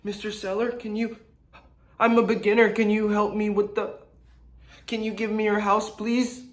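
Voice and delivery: high, pleading needy voice; needy beginner. Retold or imitated. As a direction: beg the seller like a desperate beginner. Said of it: "stop being needy".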